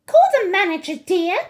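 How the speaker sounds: very high, loud indignation; shrieky, affronted wife; outraged mother. A narrator's reading. Mrs. Jenkins complains of the grandmother, high and outraged.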